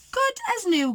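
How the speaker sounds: high-pitched, satisfied Goldie voice; pleased Goldie. A narrator's reading. Goldie admires her repaired chair, high and pleased.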